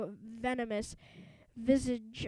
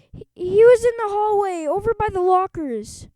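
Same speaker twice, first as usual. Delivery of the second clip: frightened; 9 semitones higher, 11 dB louder than usual. Acted. Boys faking ghost scare.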